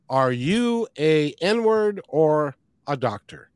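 slow, deliberate title announcement; slow title read. Real, not acted. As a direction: spell out the title slowly.

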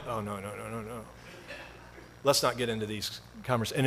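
low, hushed string of refusals; soft, sinking reluctance; quiet dread. Retold or imitated. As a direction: mutter it inwardly, backing away. Inward dread at political talk.

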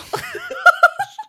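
high, loud squealing laugh; real laughter. Real, not acted. Laughing at limp noodle line.

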